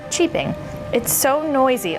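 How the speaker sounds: usual speaking voice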